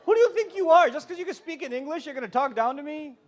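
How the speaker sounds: loud, high mock outrage; offended parent. Parent feeling talked down to by their child, indignant outburst. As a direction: act out an affronted parent's sharp retort.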